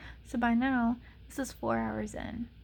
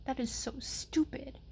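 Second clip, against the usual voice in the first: whispered threat, half joking; angry whisper; 4 dB quieter than usual. Real, not acted. To viewers about the clinic girl, mock-menacing whisper. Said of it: "I'm just pissed".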